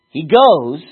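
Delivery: emphatic "goes"; stress on goes. Shown as a demonstration. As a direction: hit "goes" hard. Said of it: "I emphasize goes".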